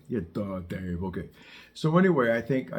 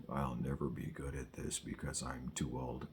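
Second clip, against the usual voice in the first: mock defeated student, lower and much softer; mopey student; 9 semitones lower, 13 dB quieter than usual. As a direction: mumble it like a defeatist adult learner. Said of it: "The number one idea is that".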